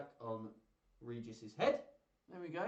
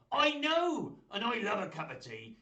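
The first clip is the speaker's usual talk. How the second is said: excited; 6 semitones higher, 7 dB louder than usual. Pretend. Puppet exclaims.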